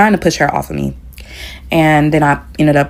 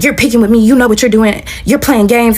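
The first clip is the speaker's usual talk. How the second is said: mock accusing; 7 semitones higher than usual. Acted accusation in retold quarrel.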